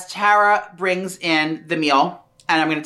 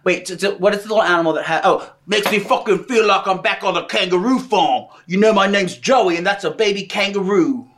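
put-on Australian accent. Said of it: "none of them have ever said that".

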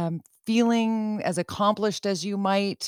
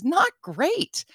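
'Not great' pitched up high, rueful; high mock answer; 11 semitones higher than usual. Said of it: "And the answer is".

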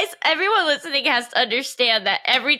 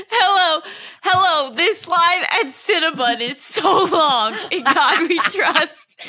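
giggly scammer voice; 3 semitones higher than usual. Acted. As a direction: play the scammer while cracking up. Acting the scammer, laughing.

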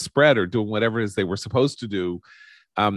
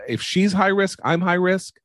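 indignant; 8 semitones higher than usual. Real, not acted. Angry rant about high risk.

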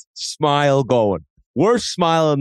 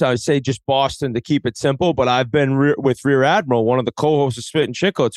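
ordinary delivery

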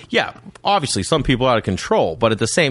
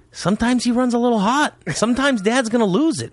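candid dad; 11 semitones higher than usual. Retold or imitated. Dad explaining temper to son.

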